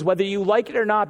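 ordinary talk

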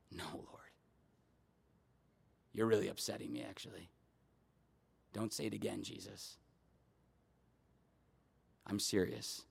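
very low, soft, earnest pleading; hushed, wounded insistence; hushed protest. Acted. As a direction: protest under your breath, almost whispered. Acting Peter's protest to Jesus.

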